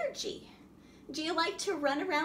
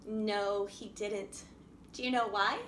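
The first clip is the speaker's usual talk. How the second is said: usual speaking voice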